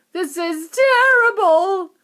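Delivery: high, loud, slow wail. A narrator's reading. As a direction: lament in despair. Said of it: "wailed Missus Teavee".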